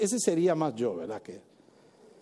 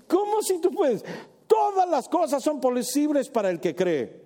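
exclaimed rebuke; 9 semitones higher than usual. Acted. Jesus answering the father.

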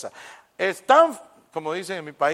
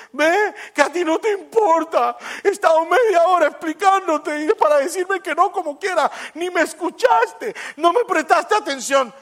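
much higher, louder indignant whine; offended complaint; 11 semitones higher, 8 dB louder than usual. Acted. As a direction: sound hurt and ignored. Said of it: "la gente se enoja y dice". (persona ofendida reclamando, mucho más aguda)

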